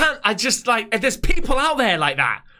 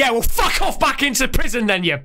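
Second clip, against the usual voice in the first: real anger, pitched up; angry retort; 4 semitones higher than usual. Real, not acted. Viewer answering a killer's plea, angry retort. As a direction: fire back in disgust, higher than usual.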